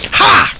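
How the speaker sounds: put-on sharp high cackle of an old woman; old lady cackle. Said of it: "cackled the old lady".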